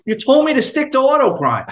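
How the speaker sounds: high-pitched retold comeback; protesting. Retells protesting the lieutenant's scolding.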